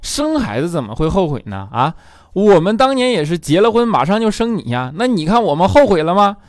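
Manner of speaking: hammed-up father taking offense; indignant dad. Dad indignantly tells son about having him, loud high voice. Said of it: "他爸就不干了".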